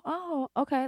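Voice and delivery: high, soft retold "oh"; quietly surprised, accepting it lightly; mildly taken aback. Stylist hearing she never cried in another chair, mildly surprised. Reacts to client's unexpected confession.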